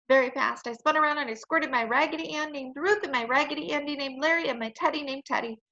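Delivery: plain reading voice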